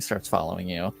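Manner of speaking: ordinary talk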